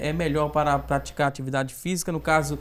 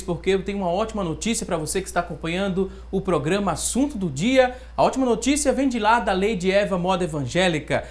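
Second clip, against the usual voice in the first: infomercial; 4 semitones higher than usual. Acted. Host launches sponsor ad.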